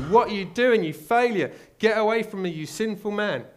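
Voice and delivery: high, loud harsh yell at a failure; mock scream. Imagined angry Jesus yelling at a sinner, loud high scream. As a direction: bark it like an angry judge. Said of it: "he's gonna scream at you".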